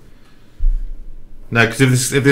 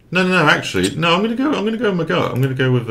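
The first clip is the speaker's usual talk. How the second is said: high, excited, slightly cocky; cocky rush of confidence; a bit cocky; 9 semitones higher, 3 dB louder, faster than usual. Real, not acted. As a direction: get carried away, a bit too sure of yourself. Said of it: "I'm sounding a bit cocky now".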